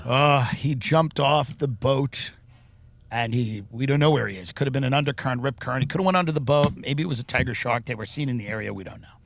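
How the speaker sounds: lightly voiced grieving parent; a hint of a broken parent explaining. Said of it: "the parents have to go out and say".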